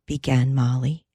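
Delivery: ordinary delivery, nothing marked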